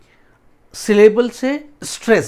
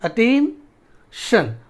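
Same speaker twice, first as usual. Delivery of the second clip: Attention split by a long pause before शन; stress on final syllable; 4 semitones higher, 3 dB louder, much slower than usual. Shown as a demonstration. Teacher showing students the stressed ending, split off. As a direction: say attain, wait, then a separate शन. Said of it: "शन पे ज़्यादा पड़ रहा है".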